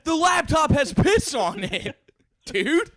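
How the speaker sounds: high, loud, slow outraged shout. Retold or imitated. Shouting to friend about the ruined laptop. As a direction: yell the disaster in disbelief.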